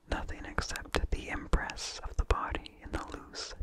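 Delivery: ordinary delivery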